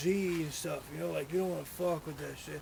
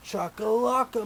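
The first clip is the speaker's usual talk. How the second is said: ordinary talk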